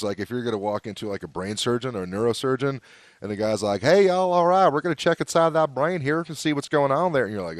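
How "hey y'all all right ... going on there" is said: put-on Southern US accent. Brain surgeon greeting patients, in mock Southern accent. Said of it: "suddenly there's a completely different accent".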